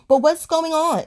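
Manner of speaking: high, whiny mock of a nosy girl; mimicking the girlfriend's nosy question. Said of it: "she was like".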